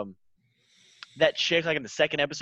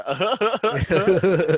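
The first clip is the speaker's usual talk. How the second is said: chuckling laugh put on louder and higher; imitated Seth Rogen laugh; 5 semitones higher, 5 dB louder than usual. Showing the co-host an actor's laugh, imitated chuckle. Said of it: "I like his laugh".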